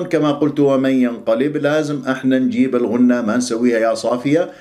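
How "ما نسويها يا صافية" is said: usual speaking voice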